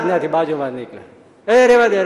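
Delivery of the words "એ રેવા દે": loud, higher call to break it up; calling out to stop. Retold or imitated. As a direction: shout them apart. Maharaj calling out to stop fight. (મોટેથી રોકવાની બૂમ)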